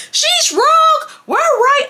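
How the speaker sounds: high, loud squawk; high-pitched mock protest; mock arguing yell. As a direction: squawk it like a childish argument. Acted arguing she would not do.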